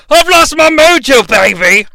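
loud, high-pitched swinging-spy impression; Austin Powers. Critic breaking into film catchphrase, loud comic impression. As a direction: burst out in a hammed-up comic spy voice. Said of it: "an Austin Powers impression".

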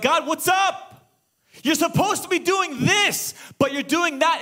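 high, indignant acted shout; angry with God. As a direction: confront God in loud disbelief. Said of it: "now he's angry with God".

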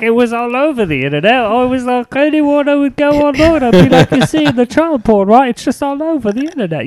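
high, loud hammed-up celebrity voice; mock guilty rock star. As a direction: play the guilty star making excuses. Mock confession in a put-on voice.

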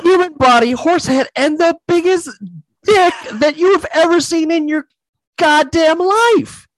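excited disbelief. Real, not acted. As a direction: wind up the shocking reveal, drawing it out high. Retelling a shocking movie scene.

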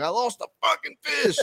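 mock exasperated wail. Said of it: "I'm like".

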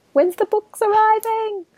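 high-pitched put-on pleading voice; impatient shopkeepers. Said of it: "all my local stores ... are waiting desperately".

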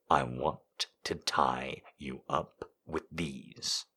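low, slow seductive growl; seductive demon purr. Acted. Jace voiced, low and soft.

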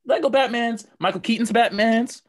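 high, loud and gleeful; excited. Real, not acted. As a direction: shout out the list happily. Playful Batman list.

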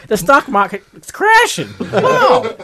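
put-on high, loud, laughing old-timey voice. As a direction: announce the crash like a showman. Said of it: "old-timey".

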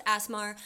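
"asthma" said as "ass-mar"; mock asthma pronunciation. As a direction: say "asthma" with an "-ar" ending. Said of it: "which is what they say".

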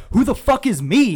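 loud, high yell into the phone; angry yell. Retold or imitated. Retelling the manager yelling at the prank caller, furious yell. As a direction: bark it out, furious. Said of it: "he goes".

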